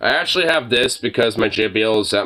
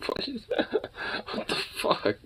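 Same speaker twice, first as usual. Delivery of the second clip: hushed whisper, puzzled; whispered surprise; 11 dB quieter than usual. Real, not acted. Owner whispers at dog sniffing around. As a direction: breathe it under your breath.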